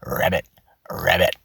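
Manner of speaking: low croaking ribbits; frog croak. Shown as a demonstration. Coach demos a frog's croaked ribbit. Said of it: "or like you're a frog going".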